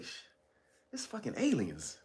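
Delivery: softly, offhand, it's fucking aliens; mock nonchalant hush. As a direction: drop it casually like old news. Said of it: "he just said it like that".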